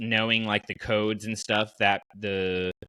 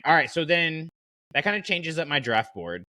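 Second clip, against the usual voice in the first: normal talking voice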